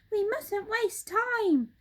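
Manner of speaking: high, slow urgent monkey cry; Mugglewump crying out. Acted. Mugglewump rushing the birds, urgent high cry. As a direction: urge them on, high. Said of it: "cried Mugglewump".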